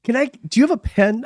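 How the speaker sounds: high-pitched tentative request; retelling a fan's flustered question; starstruck asking. Retold or imitated. A starstruck fan approaching a celebrity, asking in a high voice.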